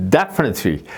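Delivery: slow definitely with the weight on DEF; definitely said slowly, DEF stressed and the rest dropping; stress on first syllable. Shown as a demonstration. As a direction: stretch out definitely, hitting DEF first then falling. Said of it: "강세 위치가 이제 첫 번째 음절에 있는 경우".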